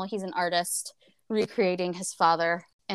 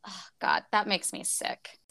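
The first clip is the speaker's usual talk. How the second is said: ordinary talk, nothing marked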